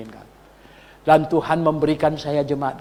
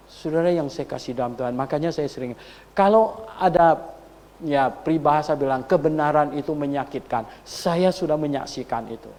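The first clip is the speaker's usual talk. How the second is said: usual speaking voice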